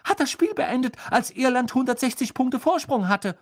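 high, gleeful disbelieving yell. A narrator's reading. Ron mocks Krumm's catch while clapping, high yell. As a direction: keep exclaiming high over the applause. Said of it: "brüllte Ron".

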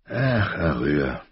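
slow weary sigh on the name. A narrator's reading. Father sighing over his wild daughter, slow and weary. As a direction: let a tired sigh carry it. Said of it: "Ihr Vater seufzte".

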